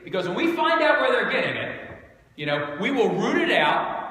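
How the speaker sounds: hammed-up indignant threat; mock outrage. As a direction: play the outraged hunter of culprits, pitched high.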